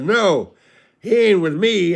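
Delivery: high, loud mock-upset denial; put-on weepy indignation; imitated bawling. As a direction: deny it like a bawling drunk. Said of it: "And he's bawling".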